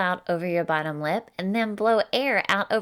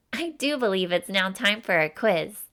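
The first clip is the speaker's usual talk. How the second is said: ordinary delivery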